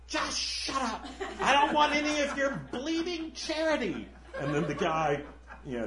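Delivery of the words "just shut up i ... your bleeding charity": high, put-on voice, laughing through it; snappy ghost. Ghost refusing the murderer's charity, high and scornful. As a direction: snap back with a laugh under it. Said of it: "the guy's like".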